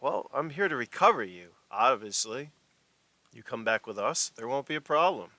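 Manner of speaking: lightly voiced enforcer laying out terms; a hint of a villain giving an ultimatum; menacing corporate agent. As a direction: deliver a measured threat, with pauses. Game master plays corporate agent.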